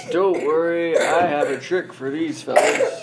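put-on confident old wizard. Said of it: "And he says".

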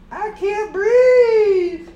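loud, strained cry, slow and high; choking fish. Acted. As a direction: gasp it out like a fish out of water. Fish gasping, voiced by parent.

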